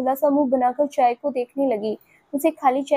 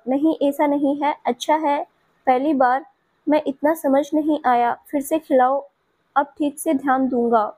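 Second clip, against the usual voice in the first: ordinary delivery, nothing marked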